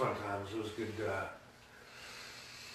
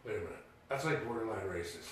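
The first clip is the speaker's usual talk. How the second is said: much softer, lower wary remark; quiet mock objection; 5 semitones lower, 13 dB quieter, faster than usual. Coworker quietly calling out a joke, low and soft. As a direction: say it low and suspicious. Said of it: "he goes".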